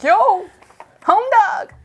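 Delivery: high mock buddy greeting; casual bro voice. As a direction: greet like a laid-back pal. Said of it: "for the entity to be like".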